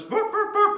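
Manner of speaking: high, loud mock dog barks; Lassie dog bark. Imitating Lassie barking to get help, high yaps. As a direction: yap like Lassie trying to warn. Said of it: "the dog goes".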